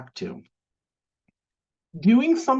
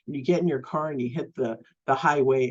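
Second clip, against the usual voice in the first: ordinary talk, nothing marked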